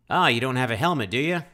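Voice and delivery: put-on Southern twang, question rising; hammed-up Southern accent asking about the helmet. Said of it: "this southern accent".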